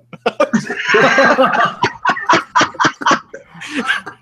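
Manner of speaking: long, airy, wheezing laugh; breathless laughter. Real, not acted. After the rude reply punchline, wheezing laugh.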